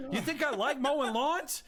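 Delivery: high, fast, indignant rant. Acted. Lou ranting to his friends, high and fast. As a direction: rant like you've been wronged.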